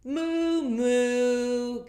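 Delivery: loud, high mooing cow; the cow answers with a loud moo. Acted. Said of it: "And the cow said".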